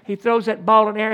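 ordinary talk, nothing marked

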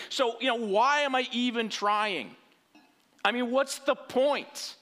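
high, loud bitter complaint at God; loud indignant grumble, pitched high; angry Cain. Acted. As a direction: rant at God like a wronged man. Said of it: "It's like anger".

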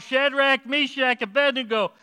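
calling out high and loud into the furnace; hollering king. Acted. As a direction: shout the names across the fire. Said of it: "he hollered".

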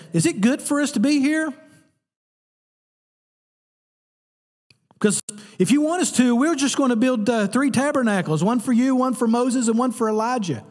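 high, quick, eager offer; eager Peter. Acted. Peter eagerly offering to build three tabernacles, high and quick. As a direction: pitch it high and eager, oblivious. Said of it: "the audacity".

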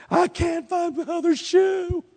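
hammed-up high teenage whine; whining teenager. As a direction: imitate a teenager in crisis over nothing. Teenager making everything an issue.

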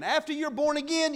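usual speaking voice

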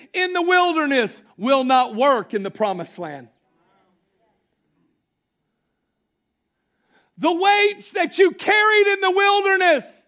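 shouted, high-pitched sermon climax; full-force preaching. Acted. Preacher shouting freedom to the church. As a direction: preach it out loud, rising and shouting.

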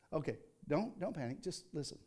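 hushed, calming reassurance; soothing. Real, not acted. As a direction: settle a startled crowd softly. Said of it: "calming the congregation".

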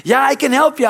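high, loud answer called down; booming out a reply from above; voice from heaven. Acted. Heaven answering the man on the branch, called-down voice. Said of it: "a voice from heaven says".

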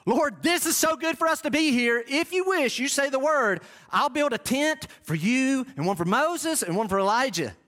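high-pitched eager pleading; zealous Peter. Acted. Peter offers Jesus tents, high and zealous. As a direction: gush with great zeal, pitched well up. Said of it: "what quality is Peter exhibiting".

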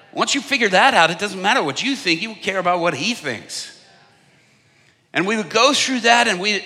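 loud, high full-force preaching; fiery sermon voice. Acted. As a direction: preach it hard to the crowd.

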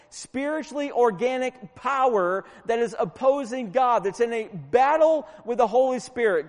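forceful preaching. Real, not acted. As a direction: preach it hard, punching the key words.

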